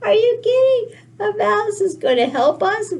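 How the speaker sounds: high, loud incredulous guffaw; mocking laughter. Everybody laughing at a mouse helping, high hilarious disbelief. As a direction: hoot with laughter at the absurd idea. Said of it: "everybody thought that was the most hilarious thing".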